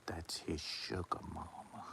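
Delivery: low conspiratorial whisper; voiceless gossip murmured to a friend; secret whisper. Retold or imitated. Coma gossips secretly about Buck.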